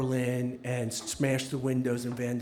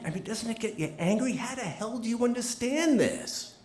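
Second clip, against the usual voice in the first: higher, urgent retold plea; impassioned outburst; 7 semitones higher than usual. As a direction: pour out anger and disbelief at a dying man's bedside. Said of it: "I just started saying".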